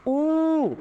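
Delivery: high held ऊ sound; vowel ऊ demo. Shown as a demonstration. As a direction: round the lips for a long ऊ. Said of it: "बड़ा ऊ".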